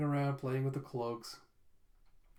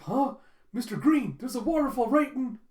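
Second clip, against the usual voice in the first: much higher, louder panicked warning; a high, loud frightened warning; alarmed shout; 9 semitones higher, 8 dB louder than usual. Retold or imitated. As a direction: raise the alarm in a rush. Jeff spots waterfall, alarmed.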